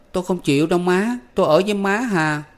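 put-on boy in tears refusing to leave mother; tearful boy. A narrator's reading. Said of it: "cũng chảy nước mắt".

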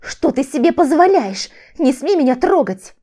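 high, loud outraged outburst; explode, barely holding back; furious woman warning him off; angry explosion. A narrator's reading. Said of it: "Взорвалась Виктория, с трудом сдерживаясь".

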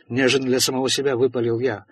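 usual speaking voice

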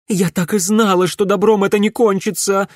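high, loud accusation; angry reproach. A narrator's reading. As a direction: throw it out as a bitter reproach. Mother in a family quarrel. (гневный упрёк на повышенных тонах)

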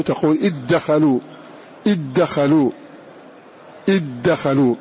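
إذ دخلوا said "iddakhalu", with a doubled د; dh merged into d. Shown as a demonstration. Teacher showing students: إذ دخلوا as "iddakhalu". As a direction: close the ذ straight into the د. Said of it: "تنطق بالدال فقط".

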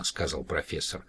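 usual speaking voice

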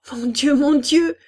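high, loud cry of alarm; panicked cry. A narrator's reading. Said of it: "cria-t-elle". (cri de panique)

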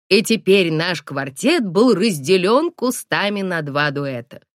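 plain reading voice

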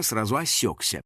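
ordinary delivery

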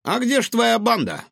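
high, lightly voiced raccoon; curious raccoon. A narrator's reading. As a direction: ask it like a cheeky raccoon. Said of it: "поинтересовался Гаврош".

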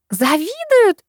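very high squeaked question. A narrator's reading. Girl incredulous that schoolgirls would envy her, squeaking. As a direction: squeak it out in disbelief. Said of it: "пищит Люси".

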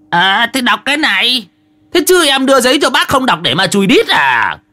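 high-pitched, put-on sarcastic comeback; indignant retort. Old man retorts to announcer's doubt.

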